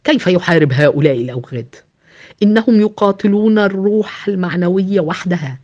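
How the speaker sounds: ordinary delivery, nothing marked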